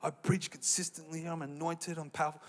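soft, smug self-important voice; mock arrogant preacher. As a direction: pretend to be full of yourself. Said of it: "I'm just joking".

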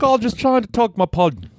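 a mock Aussie voice that misses; bad Australian accent. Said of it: "That wasn't a, an Australian accent".

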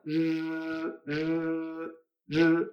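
R as a soft, sustained buzz; R china zumbante. Shown as a demonstration. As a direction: buzz the R like an electric razor. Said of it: "es como una especie de maquinilla de afeitar". (R suave, como maquinilla de afeitar)

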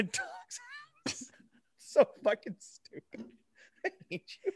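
helpless laughter breaking up the words; cracking up. Real, not acted. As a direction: wheeze it out through real laughter. Cracks up at the joke.

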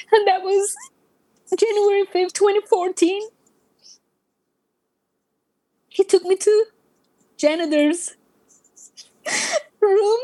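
high, halting, choked-up telling; overcome with emotion. Real, not acted. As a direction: hold back emotion between long pauses. Emotional recalling date she was saved.

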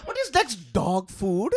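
high-pitched, hammed-up mock Indian accent; bad Indian accent. Riffing on odd delicacies for laughs, bad Indian accent. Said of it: "like the worst Indian accent".

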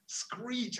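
high, drawn-out screech of tyres; mock tyre screech, pitched high; imitated brake screech. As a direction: squeal it like brakes locking. Brakes screeching as trucks stop.

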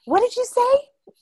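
high, loud indignant question; outraged challenge. Retold or imitated. Aunt confronting nephew, high outraged challenge. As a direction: dare him to repeat it. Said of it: "I was like".

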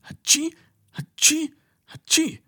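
three very high sneezes in a row; a cow caught in a sneezing fit. A narrator's reading. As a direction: sneeze again and again, unable to stop. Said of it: "konnte gar nicht mehr aufhören".

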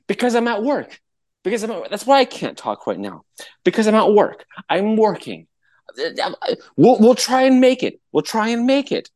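high-pitched, put-upon phone excuse; fake phone call. Faking a work call to dodge her, high and harried. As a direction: act out being busy on a call.

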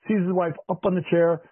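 usual speaking voice

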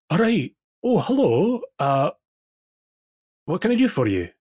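high, bright-voiced healer greeting; cleric healer. Healer greeting the party from her desk, high voice.

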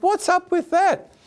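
high incredulous squeak; baffled, half-joking indignation; mock outrage. Mock outrage at wife's mood.

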